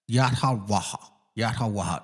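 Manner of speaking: "Yat Ha Waha" in open syllables; "Yat Ha Waha" with an airy final ha; demonstrated pronunciation. Shown as a demonstration. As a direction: model "Yat Ha Waha" part by part. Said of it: "like that".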